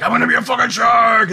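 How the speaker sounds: high, loud gleeful yell; mock threat. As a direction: shout like a cartoon villain about to attack. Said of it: "and I'm like".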